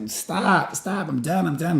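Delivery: high retold plea to stop the fight; mock pleading. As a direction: beg him to stop, half-acted. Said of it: "I was like".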